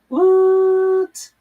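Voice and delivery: an astonished squeal, drawn out; stunned what. Real, not acted. Startled by a mention of chocolate custard.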